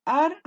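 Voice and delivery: "Árbol" with ÁR drawn out high and loud, broken from bol; stressed syllable demo. Shown as a demonstration. Teacher sounds out the word for pupils, exaggerated first syllable. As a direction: split "Árbol" into syllables, leaning hard on the first. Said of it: "¿Dónde se carga la voz?".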